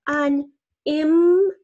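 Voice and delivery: "unimportant" broken into separate, loud, drawn-out syllables. Shown as a demonstration. Teacher sounding out a word for guided spelling, syllable by syllable. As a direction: say "unimportant" in parts, pausing between syllables. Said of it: "Think about it in syllables".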